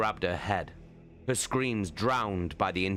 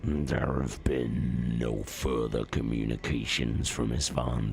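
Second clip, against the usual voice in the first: much lower, slow, heavy voice; grim commander; 7 semitones lower, slower than usual. A narrator's reading. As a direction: report the loss gravely. Mortarion reports grimly.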